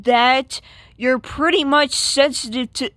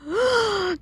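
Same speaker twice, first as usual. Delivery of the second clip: shocked gasp; 9 semitones higher, 4 dB quieter than usual. Acted. Sees boyfriend get slapped.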